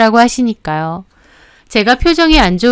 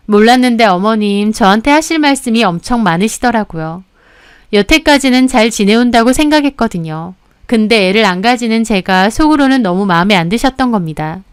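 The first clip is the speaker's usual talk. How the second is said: ordinary delivery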